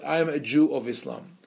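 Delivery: ordinary talk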